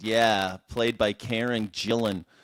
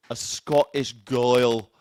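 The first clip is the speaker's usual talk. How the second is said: slow, louder mock Scottish; Scottish accent; 5 dB louder, much slower than usual. Host recalling a Doctor Who companion, playful Scottish accent. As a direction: stretch it out in put-on Scottish. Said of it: "a sweet little Scottish accent".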